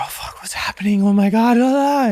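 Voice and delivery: high, loud mock panic, building up; mock freaking out. Surfer imitating herself panicking, high mock panic. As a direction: ham up the freak-out then toss it off. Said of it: "you can easily start freaking out".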